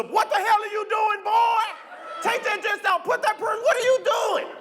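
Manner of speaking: angry yelling. Retold or imitated. Chuck yelling at son in dress.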